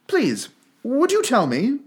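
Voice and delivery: high, slow, tentative; a little timid. A narrator's reading. Alice unsure of her manners, asks the Duchess timidly. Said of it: "a little timidly".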